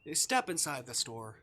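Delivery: calm storybook read of the game text; game narration. Acted. Streamer reading visual novel text to chat, calm narration. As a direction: narrate the scene evenly to the viewers.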